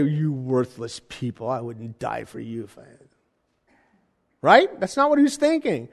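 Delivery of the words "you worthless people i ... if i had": contemptuous messiah. Retold or imitated. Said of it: "I'd say".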